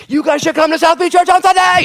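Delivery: very loud, high-pitched excited yell; excited shout. Retold or imitated. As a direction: shout the invitation, all fired up. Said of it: "she was all excited".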